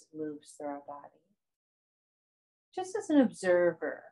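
soft, spacious yoga nidra guidance; guided meditation. Acted. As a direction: guide them unhurriedly through breath and body, softening as you go.

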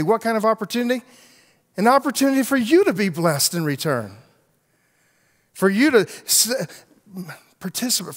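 impassioned pastor pressing the point; preaching at full force. Acted. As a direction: drive it home from the pulpit. Preaching to congregation.

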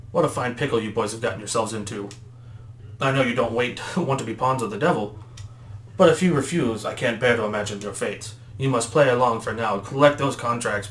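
ordinary delivery

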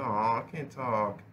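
ordinary delivery

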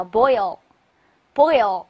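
boil, boil drawn out very slowly; very slow model reading. Shown as a demonstration. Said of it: "叫". (极慢示范boil)

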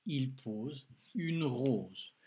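very slow, each word set apart; slow dictation reading. Shown as a demonstration. Teacher dictates the sentence to a pupil, very slowly. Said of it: "Allez, prends de quoi écrire".

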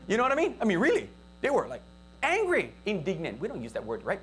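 usual speaking voice

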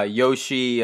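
ordinary delivery

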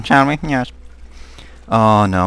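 ordinary talk

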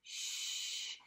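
"Shh" hushed, breath only; a long quiet shush; shh sound. Shown as a demonstration. Teacher modelling the sh sound for kids, hushed. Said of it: "make a brand new sound".

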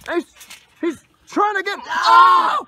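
high shouted wrestling call, building; frantic play-by-play. Real, not acted. Commentator calls a crossface submission, frantic shouting. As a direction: lose your cool as the hold locks in.

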